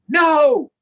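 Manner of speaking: sudden high loud No!; shout. Acted. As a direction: yell it out in protest. Wanting to shout no.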